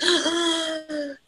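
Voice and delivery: sharp, high mock gasp; a gasp of thrilled realization; excited gasp. Telling of the first sign of labour, excited gasp.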